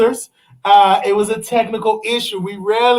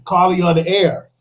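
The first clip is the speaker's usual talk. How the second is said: ordinary delivery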